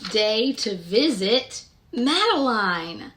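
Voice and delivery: gentle, sing-song picture-book narration; storybook reading. Acted. Reading Madeline aloud to young children, sing-song storytelling. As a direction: read the rhyme aloud to little listeners.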